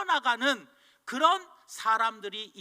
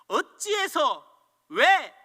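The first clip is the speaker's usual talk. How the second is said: loud, rising "어찌해서" then sharp louder "왜"; angry complaint; 6 semitones higher, 8 dB louder, slower than usual. Pretend. Preacher voices the angry person's cry at troubles. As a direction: demand why in outrage. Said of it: "분노하는 사람입니까".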